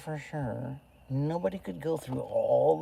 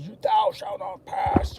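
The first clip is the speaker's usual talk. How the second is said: Gandalf quote; 7 semitones higher, 5 dB louder than usual. Retold or imitated. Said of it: "even Lord of the Rings".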